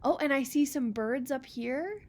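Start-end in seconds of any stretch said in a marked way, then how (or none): none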